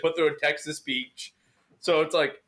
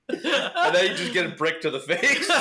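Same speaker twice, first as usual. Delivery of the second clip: laughing; 5 semitones higher, 3 dB louder than usual. Real, not acted. Laughing joke.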